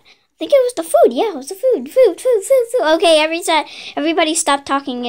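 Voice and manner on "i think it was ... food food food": high, eager food chant; overexcited, piping up about food; excited clamour. Acted. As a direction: chatter it out fast and high. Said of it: "It's getting loud in here".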